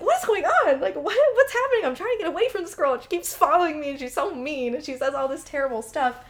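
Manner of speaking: high, loud, laughing disbelief; exasperated. Retold or imitated. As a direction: throw up your hands in frustration.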